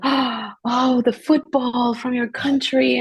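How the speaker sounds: a touch of delighted surprise, slowed on the football; excited locals. Retold or imitated. Locals recognizing her country, mildly excited.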